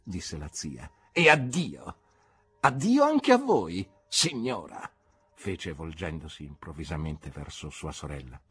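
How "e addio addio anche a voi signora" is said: curt farewell. Acted. Aunt turns to his sister.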